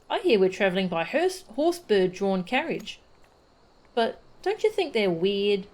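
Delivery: lightly voiced chatty child with lively swoops in pitch; eager child. Yozan greeting a traveller before the carriage ride, eager child. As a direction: play an eager, curious youngster.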